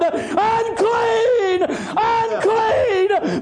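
high, drawn-out shouted warning; crying out. Acted. Leper crying out by the law of God, shouted warning. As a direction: cry it out long and high. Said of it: "He's crying out".

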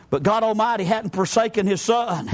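ordinary talk, nothing marked

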